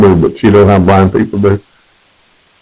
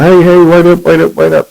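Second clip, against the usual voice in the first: calling out; 8 semitones higher than usual. Retold or imitated. Young guy running across parking lot.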